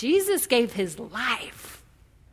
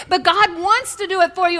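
ordinary talk

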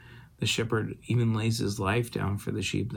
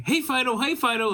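higher, bright calling out for Fido; calling the dog; 13 semitones higher, 4 dB louder than usual. Retold or imitated. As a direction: call the dog over from across the yard.

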